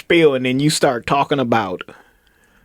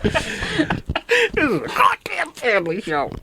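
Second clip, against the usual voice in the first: slow, hushed mock whisper; 11 semitones higher, 4 dB quieter, much slower than usual. Joking to cohosts that it's a family show, slow whisper. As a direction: breathe it out slowly like a stern secret. Mock-serious joke about bleeping.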